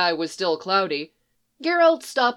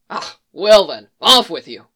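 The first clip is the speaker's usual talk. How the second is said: louder, huffy send-off; huffy dismissal; 7 dB louder than usual. Acted. The stable man huffily dismisses Geralt, loud and put out. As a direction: huff him out the door. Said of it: "the man huffed".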